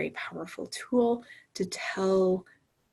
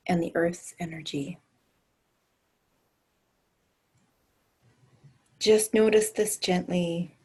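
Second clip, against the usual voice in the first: guided meditation. Acted. Leads a grounding meditation.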